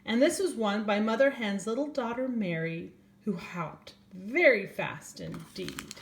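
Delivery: gentle storybook reading voice; reading to children. A narrator's reading. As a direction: read it like bedtime story time for little ones. Reads a picture book aloud.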